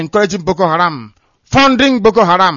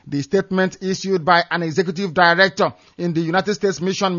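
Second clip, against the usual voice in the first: ordinary talk, nothing marked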